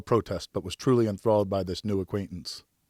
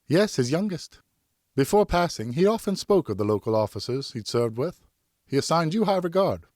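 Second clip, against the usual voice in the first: put-on young woman voice; 8 semitones higher, 3 dB louder than usual. Narrator voices Geneviève, high pitched.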